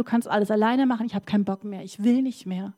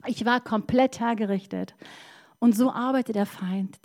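ordinary delivery

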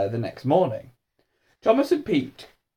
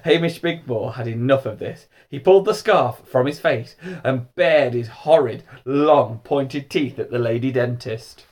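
ordinary delivery

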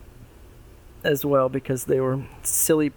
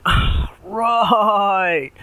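frat bro; 7 semitones higher, 6 dB louder than usual. Acted. Pig one voiced in story.

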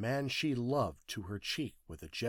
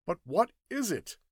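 insistent questioning; 8 semitones higher, 5 dB louder than usual. Acted. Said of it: "asked Hatch again".

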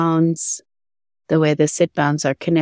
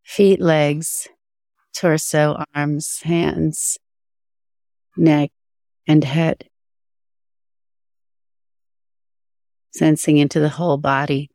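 guided meditation. Acted. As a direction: guide attention gently through the body.

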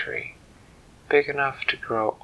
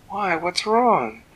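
high, louder, laughing fairy voice; bright fairy; 7 semitones higher, 4 dB louder, slower than usual. Acted. The fairy pats the trunk and cheerfully answers the tree. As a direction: chirp it out, pleased with herself.

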